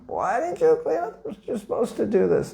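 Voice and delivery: high-pitched mock yell at the negligent devotee; retold scolding scream. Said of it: "scream at him".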